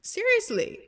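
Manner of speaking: high, loud emphatic seriously; excited emphasis. Real, not acted. Vlogger ranting about people, high excited exclamation. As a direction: throw the word up high in disbelief.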